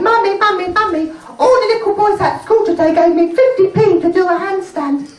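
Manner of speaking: put-on high-pitched excited little girl; little daughter. Five-year-old girl running home to tell mum, high child voice. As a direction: pipe up as an eager small child. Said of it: "She said".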